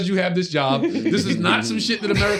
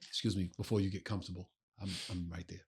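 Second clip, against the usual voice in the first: quiet excuse me; 11 semitones lower, 16 dB quieter than usual. Retold or imitated. Polite seat request retold.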